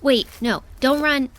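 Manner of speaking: loud, high call to stop her. Acted. Calling to her so she won't run, loud and high. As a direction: shout to stop her running.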